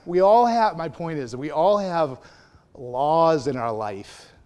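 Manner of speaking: big pulpit climax, slowing on laws in our life; emphatic preaching shout. Real, not acted. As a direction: hammer home that everyone has laws.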